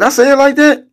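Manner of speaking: stunned, did I really say that; incredulous. Real, not acted. Streamer reacts to a chat quote he denies, high-pitched disbelief.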